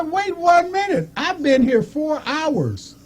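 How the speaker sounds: high, exclaimed retold outrage; indignant protest. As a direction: protest being skipped, voice shooting way up. Retold protest at being passed over.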